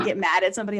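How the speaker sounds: ordinary talk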